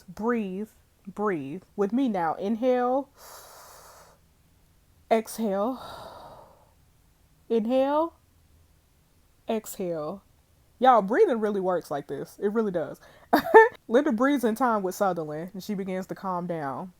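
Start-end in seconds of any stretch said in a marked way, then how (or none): 0.0-10.2 coaching breathing. Acted. Detective coaching panicked mother's breathing. 13.3-13.8 a short burst of high, loud laughter; sudden high laugh. Real, not acted. Sudden laugh after breathing aside.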